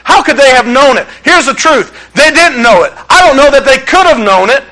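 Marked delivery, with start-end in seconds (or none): none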